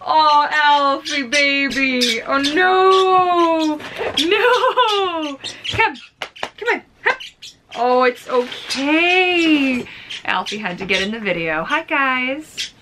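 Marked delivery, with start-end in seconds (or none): 0.1-9.8 higher, louder, slow cooing to a pet; soothing the dog. Real, not acted. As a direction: croon comfort to the upset dog.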